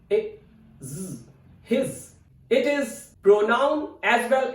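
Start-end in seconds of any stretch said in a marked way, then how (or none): 0.1-2.1 "his" sounded as "h, i, z", then whole. Shown as a demonstration. Teacher showing how "his" sounds, broken down first. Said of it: "Its pronunciation is".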